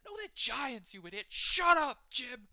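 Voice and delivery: high, loud squabbling patrons; heated, shouted bickering; angry bickering. Acted. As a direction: argue back in high-pitched irritation, exploding at the end. Two patrons bicker over monsters.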